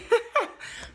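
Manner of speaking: a sudden high laugh, real and unguarded; high burst of laughter. Real, not acted. Laughing at viewer Audrey's comment, a high real burst of laughter. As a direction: let a high laugh break out.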